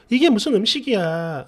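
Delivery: ordinary delivery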